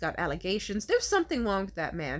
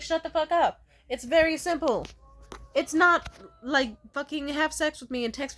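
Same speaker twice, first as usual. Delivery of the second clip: exasperated; 7 semitones higher, 3 dB louder than usual. Real, not acted. Exasperated outburst at men.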